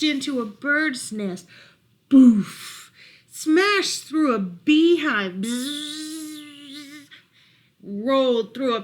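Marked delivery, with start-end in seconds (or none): 2.1-2.9 shouted crash noise boof; big impact noise; loud boof. A narrator's reading. Crash sound effect in story. 5.3-7.1 high buzzing bee noise. A narrator's reading. Buzzing bees effect.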